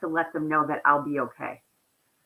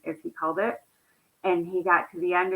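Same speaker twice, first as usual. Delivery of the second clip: usual speaking voice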